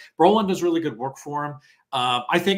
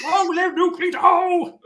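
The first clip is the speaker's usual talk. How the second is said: much higher, slow hammed-up shout; mock battle cry; 5 dB louder, slower than usual. A fan acting out the warrior's charge, hammed-up high shout.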